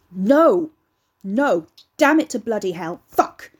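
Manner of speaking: shouted swearing in a rage; furious cursing. Acted. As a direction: explode at the mess, yelling. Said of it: "cursed and swore".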